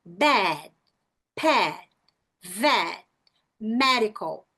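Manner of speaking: "Bad, pad, vet, medical" with open é; spaced-out demo words; short E. Shown as a demonstration. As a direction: drop the jaw on each vowel. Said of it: "deixa só cair o queixo".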